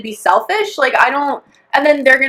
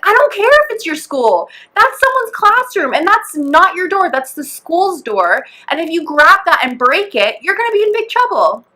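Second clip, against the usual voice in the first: higher-pitched, emphatic telling-off; retold exasperated scolding; 6 semitones higher than usual. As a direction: reenact lecturing a child who won't listen. Scolds kid in retold quarrel.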